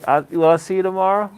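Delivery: loud quick call down the hall. Real, not acted. Calling goodbye to departing nurse.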